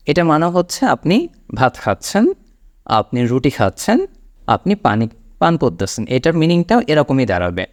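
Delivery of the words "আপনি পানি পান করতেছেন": ordinary talk, nothing marked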